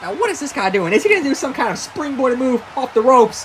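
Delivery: excited live play-by-play; wrestling commentator. Acted. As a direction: call the action ringside.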